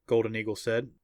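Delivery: ordinary delivery, nothing marked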